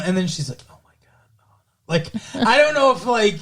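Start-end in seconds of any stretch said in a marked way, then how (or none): 0.7-1.1 barely audible mimicked murmur; imitated mumbling. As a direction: mumble it almost inaudibly, like background dialogue. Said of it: "like, mumbling almost".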